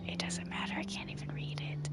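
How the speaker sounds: soft unvoiced whisper to himself. Real, not acted. Player whispering at unreadable game text, real whisper. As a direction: breathe it out under the breath.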